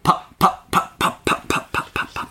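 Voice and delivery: unvoiced pops like an old tractor; popping engine noise. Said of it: "where it's a real pop".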